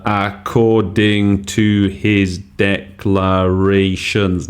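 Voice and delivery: each syllable stretched out slowly; slow, drawn out. Shown as a demonstration. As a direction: spell out "according to his declarations" slowly, syllable by syllable. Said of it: "nine syllables".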